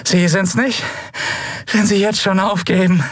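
high, strained jeer; grinning, spent defiance; exhausted taunt. A narrator's reading. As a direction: goad the captor in a worn-out high voice. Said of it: "Sie grinste erschöpft".

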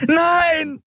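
high screamed protest; frantic high-pitched yell; desperate cry. A narrator's reading. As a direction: scream while fighting someone off. She fights him, beating his chest.

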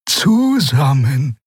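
very slow, drawn-out word; slow echoed agreement; Xer repeating. Acted. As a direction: stretch it out calmly. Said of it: "wiederholte Xer".

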